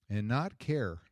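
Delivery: ordinary delivery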